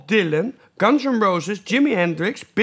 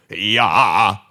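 deep low voice; 11 semitones lower, 4 dB louder than usual. Pretend. Low voice game continues.

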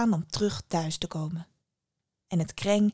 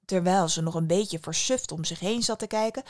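plain reading voice

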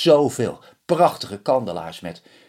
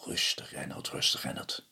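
low, soft calming voice; soothing; 8 semitones lower, 12 dB quieter than usual. A narrator's reading. Old man calms the panicked Reynold, low and soft. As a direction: settle him down gently.

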